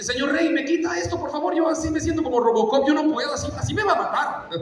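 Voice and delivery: put-on high-pitched, comic little-boy whine; complaining boy. Young David begs Saul to remove the armour, complaining. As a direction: plead from inside armour far too heavy.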